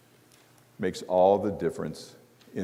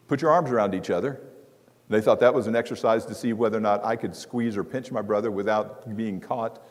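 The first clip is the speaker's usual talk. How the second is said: ordinary talk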